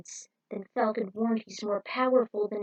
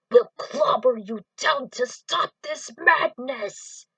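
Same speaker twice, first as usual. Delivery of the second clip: slow, louder gruff put-on voice; brute threat; 4 semitones higher, 6 dB louder, slower than usual. Beastman threatens the villain, a slow brute voice. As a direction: growl it like a brawler.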